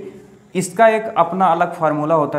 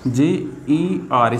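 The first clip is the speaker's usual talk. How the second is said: very slow, letter by letter; spelled out slowly; 4 semitones lower, much slower than usual. Shown as a demonstration. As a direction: draw out each letter of GER. Spelling out letters while writing.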